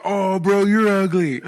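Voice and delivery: hammed-up bro reaction; mock dismay. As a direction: exclaim it like a stunned bro. Acting out TikTok guy's shocked reply.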